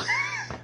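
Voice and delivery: short high squeal of a laugh; shrieking giggle. Real, not acted. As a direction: squeal with delight. Reacting to chat question.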